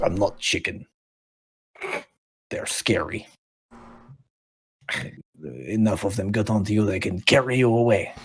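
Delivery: lightly voiced wary character; defensive scared creature. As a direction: protest in a light, uneasy character voice. Game master voices defensive creature.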